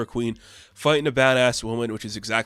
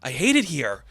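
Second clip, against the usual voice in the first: high, loud hammed-up tantrum; mock frustrated outburst; 10 semitones higher, 5 dB louder than usual. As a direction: play his breakdown as a high-pitched, exasperated rant. Acting out the hero's breakdown.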